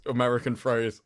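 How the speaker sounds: ordinary talk, nothing marked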